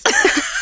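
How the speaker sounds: short high laugh; quick real laugh. Real, not acted. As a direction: laugh at the punchline. Laughs after punchline.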